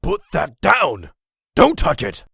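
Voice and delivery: sharp, loud, high warning; alarmed shout. Acted. Old man shouting at Simmons to drop the weapon. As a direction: yell to stop him touching it.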